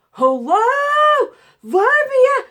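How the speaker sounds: high, loud imitation of screaming for help; retold scream. Patient calling to MRI techs to stop, retold screaming. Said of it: "and I was screaming".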